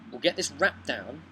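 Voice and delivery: ordinary talk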